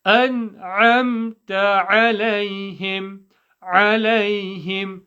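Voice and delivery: slow, careful chanting; drawn-out teaching recitation; Quranic recitation. Shown as a demonstration. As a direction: recite syllable by syllable from the throat.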